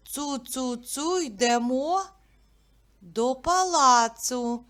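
nursery rhyme chant; sing-song rhyme. Shown as a demonstration. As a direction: chant it for little kids. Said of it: "Я вам буду називати початок".

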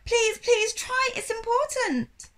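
high, slow desperate plea; pleading. A narrator's reading. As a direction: beg him to try. Sophie begging.

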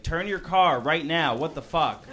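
irritated driver yell. Retold or imitated. Driver yells at the woman blocking his turn, mock irritated. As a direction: act out an impatient driver calling ahead.

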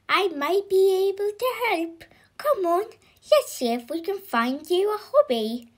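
high, bright-spirited character voice; cheerful helper. Acted. Mister Happy offers to find a hobby, cheerful. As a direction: offer help eagerly, pitched high.

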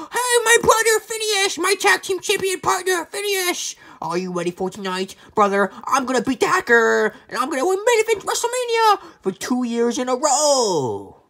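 put-on excited high kid voice; high cartoon voice. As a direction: squeak with eager excitement. Phineas figure, high voice.

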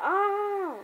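high-pitched mock scream; imitated scream. Comic fan voicing Rick seeing zombies, imitated scream.

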